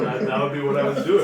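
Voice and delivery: ordinary talk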